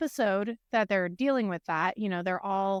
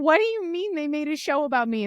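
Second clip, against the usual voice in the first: higher-pitched retold disbelief; mock-baffled reply, pitched up; incredulous; 5 semitones higher, faster than usual. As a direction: question it like you can't believe it. Quotes own disbelieving reply to friends.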